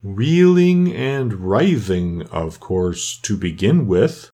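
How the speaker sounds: very slow, long-drawn vowels; doleful turtle. Acted. Mock Turtle lists his lessons for Alice, slow and doleful. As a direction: stretch each subject out ponderously.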